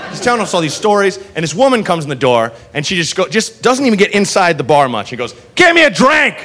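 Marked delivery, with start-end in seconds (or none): 5.5-6.3 high-pitched call across a bar; pitched-up comic holler; mock drunken demand. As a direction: imitate a drunk demanding service from the doorway. Drunk woman demands a drink.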